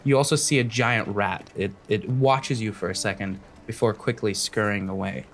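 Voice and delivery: measured scene-setting storyteller; game master narration. Acted.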